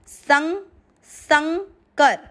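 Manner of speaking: very slow demo. Shown as a demonstration. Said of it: "slow syllable demo".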